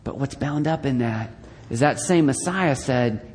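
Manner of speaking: ordinary talk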